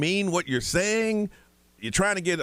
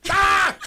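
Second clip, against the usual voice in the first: triumphant yell; 7 dB louder than usual. Acted. Shouting after the Spanish phrase.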